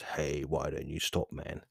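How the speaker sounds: low, very soft coaxing; creeping devil voice. Acted. Devil creeping in to halt training, low and insinuating. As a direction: slip it in quietly, a sly tempter. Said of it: "the devil creeps in and says".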